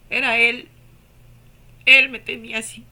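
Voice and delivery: loud and moved; emphatic. Real, not acted. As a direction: insist it was Him, almost shouting. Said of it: "overwhelmed recalling the embrace".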